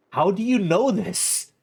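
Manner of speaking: loud, slow, stunned ask; slow loud disbelieving question; shocked question. Real, not acted. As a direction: demand it in disbelief. Reacting to the ten-day deadline.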